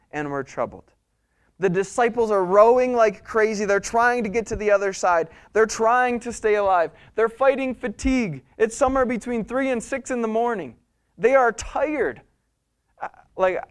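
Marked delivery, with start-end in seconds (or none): 1.6-12.2 higher, urgent sermon voice; impassioned preaching. Real, not acted. Preacher dramatizing the exhausted rowers' struggle for the congregation, impassioned. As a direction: drive each phrase home with raised pitch.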